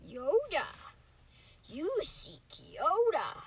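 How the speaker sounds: wise little alien with swooping pitch; drawn-out, up-and-down creature voice; Yoda. Acted. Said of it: "I'm practicing my Yoda impression".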